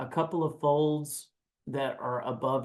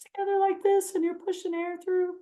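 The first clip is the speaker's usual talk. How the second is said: falsetto. Shown as a demonstration. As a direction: talk in a very high falsetto. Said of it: "when you get in your falsetto voice".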